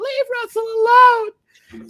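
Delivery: very high, loud, drawn-out slow shriek; hammed-up scream. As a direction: wail it out in mock hysteria. Repeats scream with player's name.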